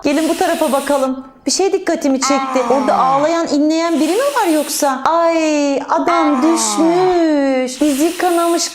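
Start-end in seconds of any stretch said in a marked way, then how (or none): 5.1-8.7 slow, drawn-out dismay. Acted. Teacher tells children of a fallen man, drawn-out dismay. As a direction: draw it out in mild alarm.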